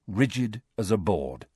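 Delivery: ordinary delivery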